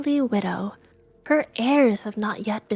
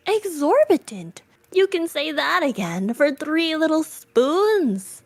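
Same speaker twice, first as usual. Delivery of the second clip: higher, louder, drawn-out disbelief; mock outrage; 6 semitones higher, 5 dB louder than usual. Young man scoffing at the dealer's price, mock outrage.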